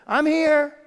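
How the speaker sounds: high, loud answering shout; dad shouting back. Retold or imitated. Father in the pool answering his daughter, loud shout. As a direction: shout up reassuringly from below. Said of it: "he would shout back to her".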